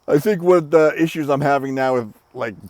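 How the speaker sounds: normal talking voice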